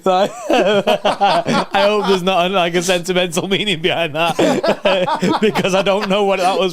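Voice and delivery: high, loud laughing through the words; slow, giggling disbelief. Real, not acted. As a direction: tease a friend while cracking up. Laughing at partner's gaffe.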